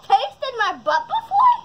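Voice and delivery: high laughing question. Real, not acted. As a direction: laugh through the line.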